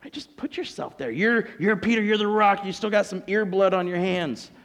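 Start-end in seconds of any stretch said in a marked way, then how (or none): none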